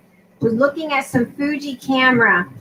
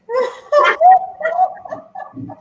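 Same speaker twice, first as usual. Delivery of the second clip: high burst of laughter. Real, not acted. Laughing at her own forgotten-baby story, high cackle. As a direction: let out a real high cackle.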